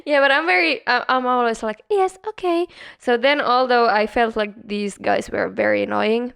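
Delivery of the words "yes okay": higher, compliant acted okay; eager-to-please yes. Receptionist who can't refuse guests, higher agreeable voice. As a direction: act out saying yes to everything.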